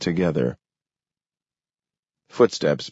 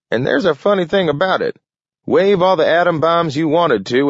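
put-on higher, louder old-timer voice, fond and proud; an old man lost in happy memories of the war's end; Gramp, old man reminiscing; 10 semitones higher, 4 dB louder than usual. A narrator's reading. Gramp telling young Adams about his granddaddy, higher and louder.

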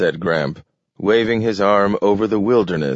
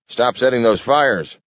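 high shouted order; yelling; 6 semitones higher than usual. A narrator's reading. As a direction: bark the order. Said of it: "yelled Carter".

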